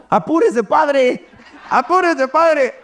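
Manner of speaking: high-pitched imitation of an impatient flock; urgent mock calling. Flock running ahead calling back to the priest, high and urgent. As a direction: hurry the priest along in a lifted, playful voice.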